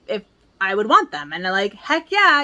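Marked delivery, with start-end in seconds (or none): 1.7-2.4 excited reply. Retold or imitated. Retelling own excited reply.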